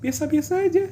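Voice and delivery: high, far voice, mock nonchalant; mock casual shrug. Mimicking friends who find it no big deal, high mock tone. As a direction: imitate people shrugging it off. Mocking how others react.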